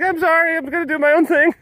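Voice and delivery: very high put-on voice; hammed-up apology while walking out; defiant laptop voice. Laptop quitting on its owner, high put-on voice. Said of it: "I'm like".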